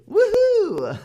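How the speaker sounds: high, loud happy whoop; excited cheer. Shown as a demonstration. Teacher cheers in the recap. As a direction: shout it out with joy. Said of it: "was haben wir heute gelernt".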